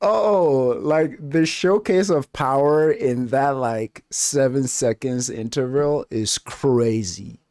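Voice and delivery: worked-up fan talking fast and loud; excited rant. Real, not acted. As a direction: gush about the power scene. Excited commentary on power display.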